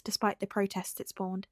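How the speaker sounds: ordinary delivery, nothing marked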